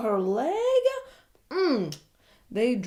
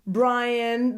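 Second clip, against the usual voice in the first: ordinary talk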